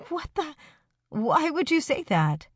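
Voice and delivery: high, startled outburst; shocked. A narrator's reading. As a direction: caught off guard, voice jumps up. Shocked by friend's pregnancy bet.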